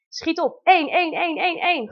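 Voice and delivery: high, loud urging with a laugh; urgent rushing. Retold or imitated. Mother in labour urging her ride to hurry, excited and laughing. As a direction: hurry the driver along, half laughing.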